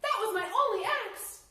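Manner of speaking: high and loud, upset about the lost axe; distressed cry. Acted. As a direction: wail it in dismay. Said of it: "the logger cried".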